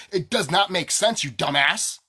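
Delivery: loud, exasperated telling-off; angry outburst. Real, not acted. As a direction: snap it at the viewer. Angry rant at the viewer.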